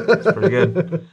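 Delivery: high, loud laugh through the words. Real, not acted. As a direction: crack up in amused approval. Amused by opponent's response.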